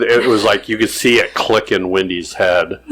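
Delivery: ordinary delivery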